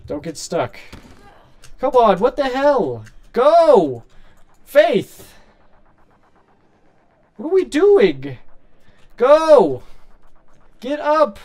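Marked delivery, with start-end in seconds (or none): 1.8-11.4 higher, louder exasperated shouts; frustrated yelling. Real, not acted. Streamer yelling at his game character Faith to move. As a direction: yell at the stuck character.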